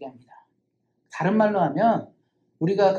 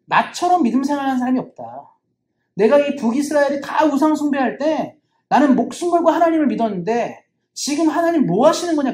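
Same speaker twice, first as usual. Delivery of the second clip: indignant complaint; 6 semitones higher, 5 dB louder than usual. Acted. Elijah complaining to God.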